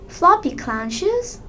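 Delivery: surprised. Acted. Startled by clown appearing.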